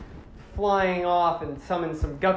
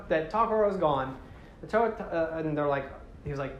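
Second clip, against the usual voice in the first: ordinary talk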